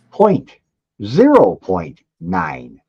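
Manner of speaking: Point, zero point nine in separate, high-pitched beats; emphatic figure. Real, not acted. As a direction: drive home "zero point nine", slowly. Spells out shockingly low speed.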